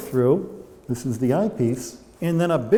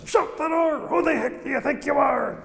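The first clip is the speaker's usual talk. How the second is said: imitating an indignant shout, pitched very high; retold angry shout; 11 semitones higher than usual. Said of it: "and he shouts".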